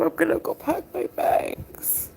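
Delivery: hushed, voiceless whisper; breathless whisper through tears; real whisper. Real, not acted. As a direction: whisper it so he won't hear. Crying, wants to leave.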